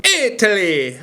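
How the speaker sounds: high, exclaimed mock Italian accent; bad Italian accent. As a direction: call it out in a badly faked Italian accent. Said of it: "I'm so bad at the accent".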